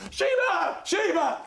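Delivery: very high-pitched mock calling; dog-calling shout. Panelist acts out a fake dog search, high calling shout. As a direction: cry out for a lost dog.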